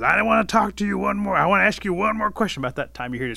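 high, loud insistence; heated demand. A narrator's reading. Bear holds Rabbit by the tail, demanding loudly. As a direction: talk over a struggler, loud and forceful.

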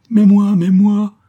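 high, loud, drawn-out wail; desperate cry. A narrator's reading. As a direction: cry out slowly in panic. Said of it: "s'écriait Olivain, pâle et désespéré".

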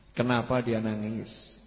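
slow, very soft, low question; gentle question. Retold or imitated. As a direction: ask it gently and slowly, hushed. Angel gently asks the weeping Prophet.

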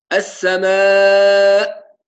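السماء drawn out slowly, firm hamza at the end; السماء with a full measured hamza; clear hamza. Shown as a demonstration. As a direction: land the final hamza of السماء fully, slowly. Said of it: "هكذا".

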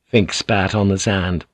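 plain reading voice

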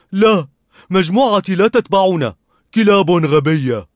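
cheerful dog reporting back; talking dog. A narrator's reading. Dog runs back to old man and boy, chatty.

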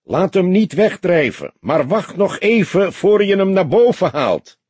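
loud, high-pitched call across the water. A narrator's reading. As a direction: call out through cupped hands to someone below. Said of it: "maakte van zijn handen een toeter".

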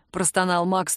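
ordinary delivery

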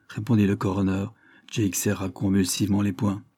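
ordinary delivery

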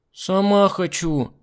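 high, very slow whine; whining child. A narrator's reading. Girl insists on doing it herself, high slow whine. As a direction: drone it out like a stubborn kid. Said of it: "занудила Миранда".

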